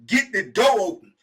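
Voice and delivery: slow, loud order; firm demand. Retold or imitated. Ordering cellmate to open the door, slow loud. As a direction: lay it down slowly. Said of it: "I said".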